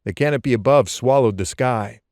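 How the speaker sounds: ordinary delivery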